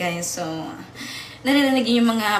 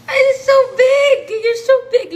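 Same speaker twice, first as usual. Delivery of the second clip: high, loud, squealing praise; 11 semitones higher, 11 dB louder, slower than usual. Real, not acted. Owner squealing at her big cat with delight. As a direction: squeal over how big the pet is.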